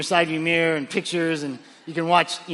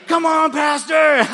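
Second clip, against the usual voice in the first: higher, louder, slow urging; loud coaxing; 10 semitones higher, 8 dB louder, slower than usual. Retold or imitated. Friend pushing the pastor to shout, loud coaxing. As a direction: egg him on loudly. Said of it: "And he goes".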